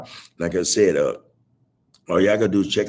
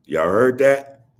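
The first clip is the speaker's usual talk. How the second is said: drawn out question; 2 semitones higher, 6 dB louder, slower than usual. Real, not acted. Repeats to stress the comment.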